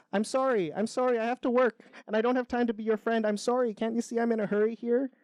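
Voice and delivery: high-pitched, apologetic Canadian beaver; lightly Canadian, high and hurried. A narrator's reading. Beaver too busy for friendship, high apologetic Canadian voice. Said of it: "the beaver says in a very Canadian way".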